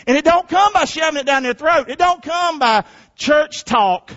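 rising preacher's call. Real, not acted. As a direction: lean into it like a pulpit preacher. Preaching at full force.

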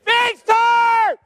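high, drawn-out congregation shout; loud shout. Acted. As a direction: yell it out and get ugly. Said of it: "Can we say it real loud and just get ugly?".

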